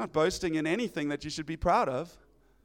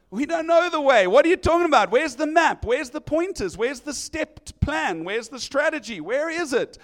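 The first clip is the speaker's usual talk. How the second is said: loud, high demanding questions; exasperated disciples; 6 semitones higher, 4 dB louder than usual. Retold or imitated. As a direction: badger him for a plan.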